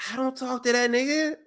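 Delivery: high, dismissive mock voice; Paul brushing off Roman, high and dismissive; mock Paul Heyman. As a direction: toss it off in a high put-on voice. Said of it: "And Paul's like".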